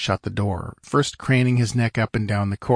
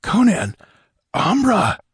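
rising higher and louder on each word, almost a cry; stunned disbelief; 10 semitones higher, 4 dB louder than usual. A narrator's reading. Merchant gasping at an old pirate's face, rising shock. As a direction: blurt the oaths in climbing shock.

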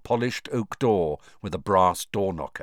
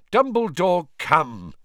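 louder, sharp exasperated call; angry; 9 semitones higher, 5 dB louder, slower than usual. A narrator's reading. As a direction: scold him impatiently. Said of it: "said Fudge angrily".

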